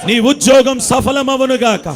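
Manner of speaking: slow, ringing pulpit declaration, pushing each line out; slow chanted blessing that shouts on the middle lines; forceful blessing proclamation. Acted. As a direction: pronounce the blessing over the crowd with full force.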